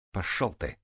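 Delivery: ordinary delivery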